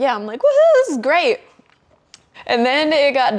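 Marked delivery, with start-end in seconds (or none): 0.4-1.4 high whooping mock delight; blissed-out festival whoop, played for laughs; giddy cheer. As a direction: whoop it like you're having a blast. Acting out her happy high self.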